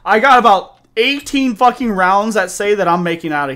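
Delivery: loud, nervous bravado; psyching himself up, loud; scared horror game streamer. Real, not acted. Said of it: "scared horror game streamer".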